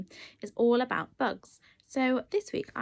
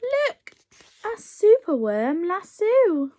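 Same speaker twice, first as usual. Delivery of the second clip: excited discovery; 9 semitones higher, 8 dB louder, much slower than usual. Acted.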